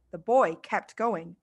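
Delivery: ordinary delivery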